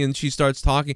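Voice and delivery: usual speaking voice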